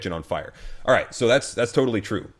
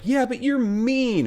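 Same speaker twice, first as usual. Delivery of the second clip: much higher, slow, mocking whine; whiny mock voice; 3 dB louder, slower than usual. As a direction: mimic her petulant complaint, high and drawn out. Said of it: "she came in and was like".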